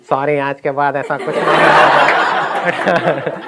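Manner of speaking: slow, odd mock voice of the teacher; funny teacher voice. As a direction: drag out the apology in a funny put-on voice. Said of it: "ऐसी अपनी उनकी आवाज़ थी बड़ी funny सी". (Sir की funny, धीमी नकल वाली आवाज़)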